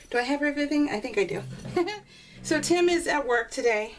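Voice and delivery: normal talking voice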